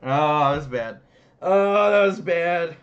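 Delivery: mortified, embarrassed wail; dismayed groan. Real, not acted. Groaning at own bad attempt.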